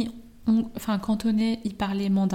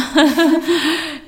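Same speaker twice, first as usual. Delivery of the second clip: loud, high burst of laughter; hearty laugh; 7 semitones higher, 10 dB louder than usual. Real, not acted. Speaker laughs at being excited to the maximum, hearty laugh.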